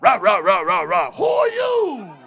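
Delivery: high, loud mocking growl of a rough talker; hammed-up rough-talking woman. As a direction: lampoon an aggressive woman.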